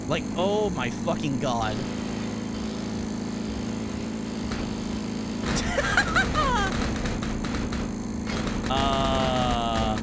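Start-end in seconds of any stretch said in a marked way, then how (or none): 1.8-8.6 real high-pitched laughing fit. Real, not acted. Player cracking up at terrible mower controls, laughing.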